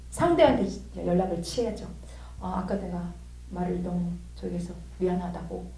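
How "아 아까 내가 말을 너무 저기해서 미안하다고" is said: very soft, lowered apology; subdued, hesitant sorry; sheepish apology. Retold or imitated. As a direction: apologize quietly and humbly. Retold apology to the other person.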